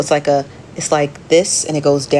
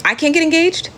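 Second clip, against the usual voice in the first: much higher, whining complaint to the chat; whiny protest; 12 semitones higher than usual. Real, not acted. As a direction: wail it like a jealous big sister. Whining about not being engaged.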